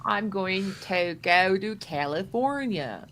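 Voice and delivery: very slow mock American; lightly mocked American; American accent. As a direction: stretch out California. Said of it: "making fun of".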